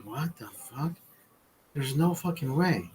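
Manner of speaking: hushed, stunned disbelief. Retold or imitated. Amazed at the guide's trick.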